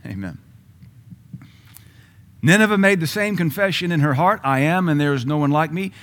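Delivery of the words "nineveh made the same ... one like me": loud, high, ringing preacher's cry; full-force preaching. Real, not acted. Preacher calling the church to hear, at full force.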